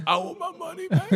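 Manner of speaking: high, softened faraway yell; imitated disgruntled customer. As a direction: mimic a distant complaint from the crowd. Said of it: "The dude that got hit goes".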